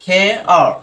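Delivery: "খে… আর" in two separate drawn-out sounds; deliberate teacher's "খে আর" with a gap; sounded out. Shown as a demonstration. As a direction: split it clearly into "খে" and "আর". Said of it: "এটা কি উচ্চারণ করছে?".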